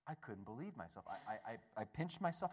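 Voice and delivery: ordinary talk, nothing marked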